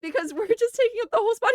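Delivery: high, teary laughter in the voice; trying to talk through a fit of giggles; real crying-laughing; laughing through words. Real, not acted. Laughing while telling funny story.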